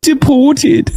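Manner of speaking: high-pitched British mimicry; mock British accent. Streamer mimicking a British person's word, mock British accent. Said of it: "hearing a British person go".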